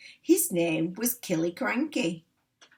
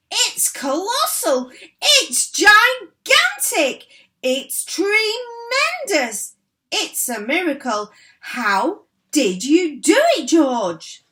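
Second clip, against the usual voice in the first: high, loud, drawn-out exclamations; thrilled; 10 semitones higher, 9 dB louder than usual. A narrator's reading. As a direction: gush with amazement. Father dancing round the giant hen.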